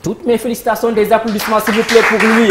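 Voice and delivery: plus fort, enthousiaste, pour lancer le public; réclame les applaudissements avec entrain; appel aux applaudissements. Real, not acted. Host calls for applause. (l'animateur au public, appel joyeux aux applaudissements)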